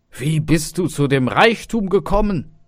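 high, loud amazement; loud, incredulous question; astonished father. Acted. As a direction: exclaim it in utter astonishment. Father astonished at son's wealth.

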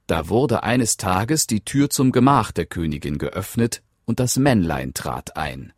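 ordinary delivery

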